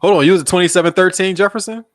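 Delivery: high, loud, incredulous question; astonished, voice jumping way up; real surprise. Real, not acted. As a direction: cut in amazed at the coincidence. Surprised at shared home street.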